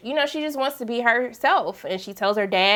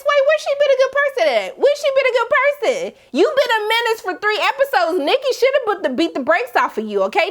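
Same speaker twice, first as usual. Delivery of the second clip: much higher, indignant; sassy call-out; 10 semitones higher than usual. Retold or imitated. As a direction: drag her with attitude. Calling out a character.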